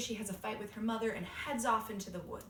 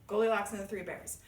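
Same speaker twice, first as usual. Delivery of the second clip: plain reading voice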